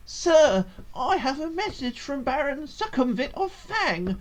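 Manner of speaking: high, breathless herald voice; eager messenger. Acted. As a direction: announce it like a panting messenger. Messenger delivers the baron's summons.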